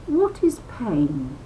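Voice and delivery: ordinary delivery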